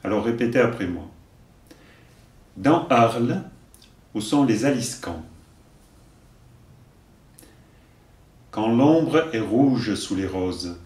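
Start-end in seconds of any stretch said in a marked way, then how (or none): 2.6-10.8 slow, deliberate verse delivery; poem recitation. Acted. Teacher giving verses for learners to repeat, measured recitation. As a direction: recite line by line, leaving room to repeat.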